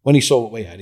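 ordinary talk, nothing marked

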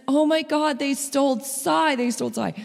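high, loud retold child's wail; imitating a bawling child. Youngest son wailing over his stolen stuffy, high and tearful. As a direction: pitch way up like a little boy crying over his toy. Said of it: "he was bawling".

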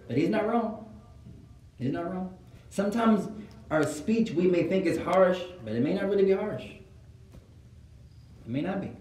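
ordinary talk

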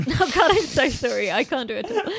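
loud, high, helpless laughter; laughing apology. Real, not acted. Podcaster giving up on the accent, laughing apology. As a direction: apologise while cracking up. Collapses after failed accent attempt.

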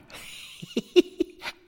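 breathy high-pitched giggle; a light unvoiced laugh; quick high chuckle; high airy laugh. Laugh heard.

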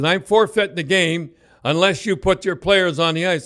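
referee's threat. Retold or imitated. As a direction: lay down the ultimatum firmly.

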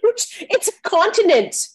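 high, laughing objection; laughing protest. Real, not acted. Objecting to Daniel's rant, high and laughing. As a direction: laugh as you push back on the insult. Protests friend's slam on Australians.

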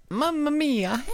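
high, slow mock Italian; drawn-out cartoon Italian cry; Italian accent. Echoing mock Italian exclamation.

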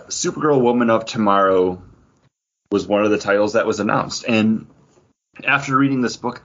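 usual speaking voice

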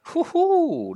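high, loud hoo-hoo; long, high celebratory hoot; whooping cheer. Real, not acted. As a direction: whoop with delight at the roll. Cheers a player's successful roll.

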